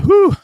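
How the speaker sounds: high, loud breath of relief; relieved whew. Retold or imitated. Teacher relieved the boy's phase is over, loud high whew. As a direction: blow out a big sigh of relief.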